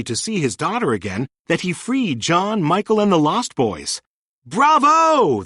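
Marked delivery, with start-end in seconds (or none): 4.4-5.4 high, loud joyful cheer. A narrator's reading. Freed Indians and boys cheering for Peter. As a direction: throw it up like a crowd cheering. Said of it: "they all cheered".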